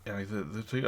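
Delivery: usual speaking voice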